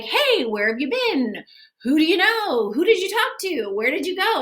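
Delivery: put-on pepper her with questions like a clingy boyfriend; mock interrogation of his girlfriend at the door; nagging boyfriend. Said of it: "he's like".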